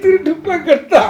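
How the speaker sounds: high-pitched sobbing wail; crying. Acted. Uncle to niece over being called a thief, crying. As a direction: wail it through sobs. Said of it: "அழுவாதீங்க சித்தப்பா".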